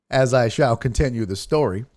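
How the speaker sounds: ordinary talk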